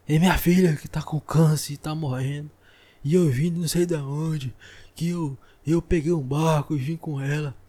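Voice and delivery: loud, whiny retelling of a stranger's woes; mimicking a stranger. Vlogger imitates a hospital stranger telling sad stories, loud and whiny. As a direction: ham up a stranger dumping her troubles on you.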